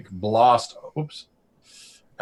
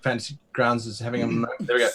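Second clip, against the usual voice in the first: ordinary talk, nothing marked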